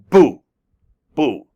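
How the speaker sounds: BOO punched out with a sharp fall; short, falling, forceful BOO; angry-sounding boo. Shown as a demonstration. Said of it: "it sounds like you're angry".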